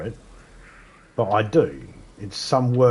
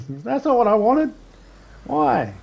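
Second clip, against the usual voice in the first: very high put-on whiny voice; confused protest; 13 semitones higher than usual. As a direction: squeak it out baffled. Acted confused protest in skit.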